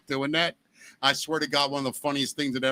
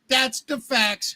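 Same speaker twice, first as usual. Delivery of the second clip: announcer sign-off; 9 semitones higher, much slower than usual. Acted. News segment sign-off catchphrase.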